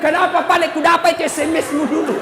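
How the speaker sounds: loud, high mock interrogation; hammed-up demand for an old grudge; mock accusing wife. Wife confronting husband about an old SMS, loud mock anger.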